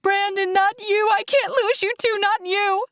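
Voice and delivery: high, desperate wail of words; distraught, high-pitched pleading; crying out. A narrator's reading. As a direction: cry out in anguish to the dying. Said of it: "I cry".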